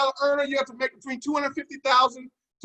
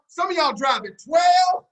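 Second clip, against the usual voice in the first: teasing call-out; 4 semitones higher, 5 dB louder than usual. Acted. Teasing the audience, preaching loud.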